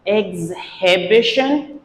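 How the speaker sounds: EXHIBITION said egz-heh-BISH-un; wrong pronunciation. Shown as a demonstration. Teacher imitates students' mistake, wrong pronunciation. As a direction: sound the H in exhibition. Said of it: "Many students pronounce it".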